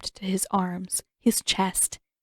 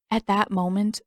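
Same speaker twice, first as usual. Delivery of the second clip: ordinary delivery, nothing marked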